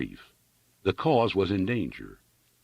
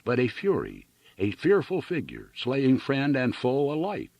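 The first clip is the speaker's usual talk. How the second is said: a speech delivered to a crowd, raised and ringing; rousing oratory; 4 semitones higher than usual. A narrator's reading. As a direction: declaim it like a public orator. Orator's speech read aloud.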